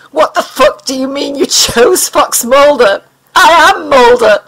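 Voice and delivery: furious disbelief, hit I am hard; outraged outburst. Acted. Mulder yells at the alien that he is Mulder, outraged.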